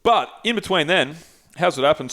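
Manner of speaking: usual speaking voice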